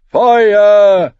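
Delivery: high, very loud shout of "Feuer"; crowd answering cry. A narrator's reading. Voices in the crowd echoing the prophet, loud answering shout. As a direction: yell "Feuer" back like a follower. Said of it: "antworteten andere Stimmen".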